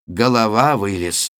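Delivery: plain reading voice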